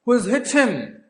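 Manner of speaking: officer demanding who did it; shocked question. Retold or imitated. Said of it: "he said".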